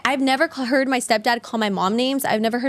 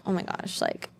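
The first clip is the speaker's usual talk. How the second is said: whisper; 4 semitones lower, 10 dB quieter than usual. Retold or imitated. Inner realization, hushed.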